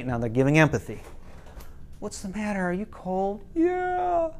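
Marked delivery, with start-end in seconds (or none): 2.0-3.4 gentle empathy. Retold or imitated. Parents give empathy to crying son. 3.6-4.3 put-on whiny kid. Crying boy answers.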